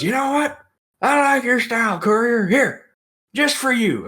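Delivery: high put-on voice, handing over a gift; hammed-up Jake character. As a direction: imitate a showman in a high voice. Jake offering the bottle, acted.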